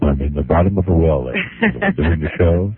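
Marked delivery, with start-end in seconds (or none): 0.0-1.3 hollow, distant dead-mic sound; like talking from the bottom of a well. Real, not acted. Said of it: "you sound like you're in a, in a tunnel".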